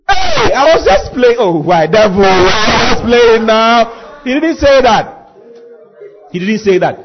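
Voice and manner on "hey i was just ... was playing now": mock cowardly pleading. Preacher acting a David who backs out, mock pleading. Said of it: "David didn't say".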